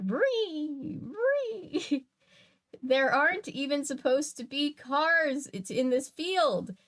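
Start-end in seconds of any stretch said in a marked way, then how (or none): 0.0-2.0 a much higher vree vree, rising on the second; higher car noise. Retold or imitated. Streamer repeating the game's car noise higher, playful. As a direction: push the car sound up high and silly. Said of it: "That's the sound".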